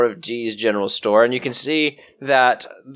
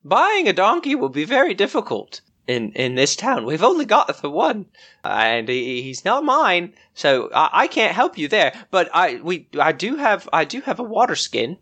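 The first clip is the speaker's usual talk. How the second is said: higher, flustered shopkeeper woman; Dee the shopkeeper; 7 semitones higher than usual. Acted. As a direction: play the startled village shopkeeper.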